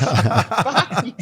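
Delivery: high, strained voice of a cranky teammate, laughing through it; Phil Kessel voice. Retold or imitated. Phil snapping at the strength coach, high put-on voice. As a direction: squeak out the curse in a put-on high voice. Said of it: "make sure you do his voice".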